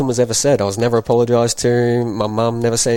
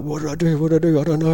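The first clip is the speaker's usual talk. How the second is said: put-on higher pleading child voice; imitating scared child; 7 semitones higher, faster than usual. Young boy begging his stepdad, higher and scared. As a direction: plead like a frightened kid.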